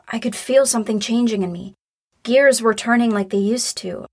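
usual speaking voice

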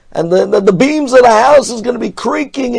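ordinary talk

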